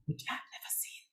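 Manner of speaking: soft whisper to the audience; hushed little aside. Real, not acted. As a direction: lean in and whisper it as a confidential aside.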